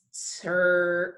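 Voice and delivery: slow-motion phonics "stir"; said slowly. Shown as a demonstration. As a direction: STIR drawn out, st sliding into a long er. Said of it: "let me say it slowly again".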